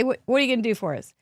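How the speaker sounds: ordinary talk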